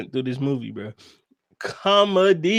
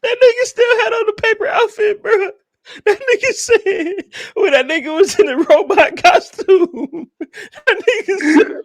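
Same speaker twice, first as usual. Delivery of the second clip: high-pitched talk through helpless laughter; giggling high while telling the funniest part; laughing hard; 12 semitones higher than usual. Real, not acted. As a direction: barely get the words out for laughing. Cracking up retelling funny scene.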